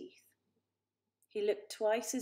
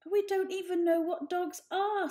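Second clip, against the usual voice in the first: higher, louder urge; urgent old cat; 10 semitones higher, 7 dB louder than usual. A narrator's reading. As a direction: press the point. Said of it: "said the elder Poor".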